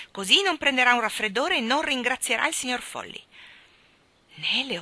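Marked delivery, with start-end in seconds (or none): 0.1-3.2 higher, louder indignant retort; angry. A narrator's reading. Mary Poppins snubbing the kiosk keeper, indignant and loud. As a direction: say it crossly, with raised voice. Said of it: "commentò con rabbia".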